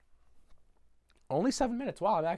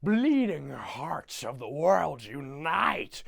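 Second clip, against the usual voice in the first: very slow, theatrical mock proclamation; Grinch; much slower than usual. Grinch hearing Cindy freak out, slow hammed-up groaning declaration. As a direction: draw out each word like a grudging hero. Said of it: "and he goes".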